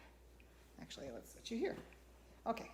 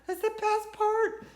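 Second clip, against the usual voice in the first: repeated higher, slow and loud; excited; 11 semitones higher, 11 dB louder than usual. Real, not acted. Sewer telling viewers about flow, repeated higher. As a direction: lift it up with delight. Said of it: "I love sewing".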